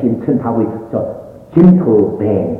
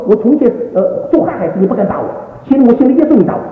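high-pitched retold complaint, swelling louder; hurt protest; 12 semitones higher, 4 dB louder than usual. Son retells protest at father's slap, indignant. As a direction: throw the accusation back at father.

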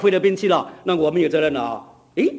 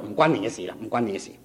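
soft brush-off; 8 semitones lower, 6 dB quieter, faster than usual. Retold or imitated. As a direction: lower and softer, fading dismissal in Cantonese. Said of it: "我不敢骂他". (低声轻轻地推开)

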